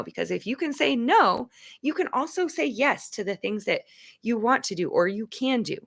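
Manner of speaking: ordinary talk, nothing marked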